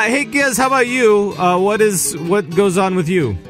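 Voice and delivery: ordinary talk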